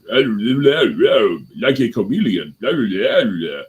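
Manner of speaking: loud mocking blah-blah, pitch swooping; hammed-up nonsense babble; mock rambling. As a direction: imitate someone droning on, loudly and dismissively. Mimicking someone's rambling blah-blah speech.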